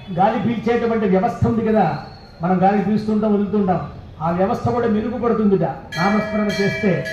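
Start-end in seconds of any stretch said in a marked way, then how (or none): none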